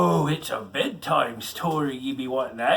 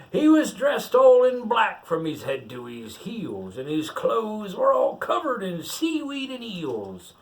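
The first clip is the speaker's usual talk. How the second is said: pirate reading a picture book aloud; story reading; 6 semitones higher, 4 dB louder, slower than usual. Acted. Pirate reads the rhyme to the child, storytelling lilt. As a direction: read the verse out like a bedtime tale.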